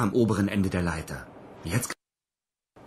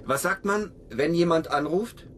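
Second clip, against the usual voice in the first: much higher, slow coaxing sing-song; talking to a parrot; 10 semitones higher than usual. Acted. Cosmonaut teaching his parrot to talk, high coaxing voice. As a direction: coax the bird like a toddler.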